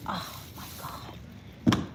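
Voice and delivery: breathless whispered gasp; gasping in icy wind. Real, not acted. Stepping into icy cold.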